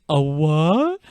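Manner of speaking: baffled Boston question, rising high; puzzled Boston local; Boston townie. Retold or imitated. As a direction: swing up into disbelief, Boston-style. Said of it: "he looks and it's like".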